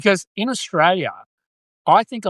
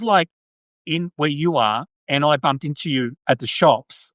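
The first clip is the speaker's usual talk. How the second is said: normal talking voice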